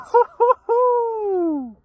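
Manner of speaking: high, loud laugh that breaks into a shout. Real, not acted. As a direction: howl with laughter.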